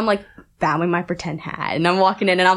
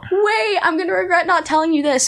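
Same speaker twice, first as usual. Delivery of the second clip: urgent plea; 11 semitones higher than usual. Retold or imitated. Runs after Becky.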